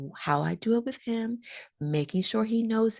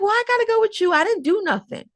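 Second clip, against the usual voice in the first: put-on high, louder whiny kid; child protesting; 6 dB louder than usual. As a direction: protest like a worried six-year-old.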